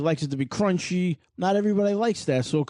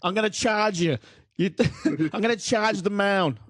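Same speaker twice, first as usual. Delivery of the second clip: bad Boston accent; 4 semitones higher than usual. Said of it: "I can't do an accent like you do".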